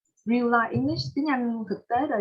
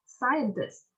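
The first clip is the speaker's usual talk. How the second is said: "scientist" stressed on SCI, falling after it; stress on first syllable. Shown as a demonstration. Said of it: "thì mình sẽ nhấn âm nhất".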